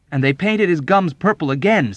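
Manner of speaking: high, loud, indignant report; outraged. A narrator's reading. Young airman on the chaplain's treatment, outraged. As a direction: hammer out the grievance in outrage. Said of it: "Nately added in outrage".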